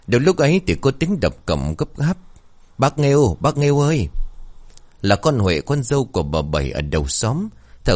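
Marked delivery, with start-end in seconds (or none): 2.8-3.4 urgent call. Acted. Neighbour bangs gate, calls out. 3.4-4.1 urgent call. Acted. Neighbour bangs gate, calls out.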